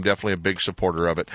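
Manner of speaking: normal talking voice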